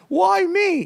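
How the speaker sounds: high, loud whiny hero; reluctant hero. Retold or imitated. Teasing Mike as the movie hero who cries why me. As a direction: wail it like the chosen reluctant hero. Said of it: "guy in the hero film".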